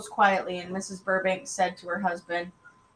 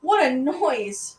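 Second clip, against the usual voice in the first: higher, louder, drawn-out outcry; disgusted complaint; 6 semitones higher, 5 dB louder, much slower than usual. A narrator's reading. Allison glaring balefully at the dog, disgusted. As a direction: stretch it out with indignation. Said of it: "glaring balefully at poor old boy".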